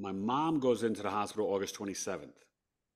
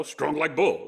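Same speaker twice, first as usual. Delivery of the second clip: hammed-up tough-guy boast, louder and higher; Ben Stiller; 4 semitones higher, 7 dB louder, slower than usual. Patient joking to himself before the paramedics, hammed-up boast. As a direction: play up a comic macho brag. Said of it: "I'm thinking Ben Stiller".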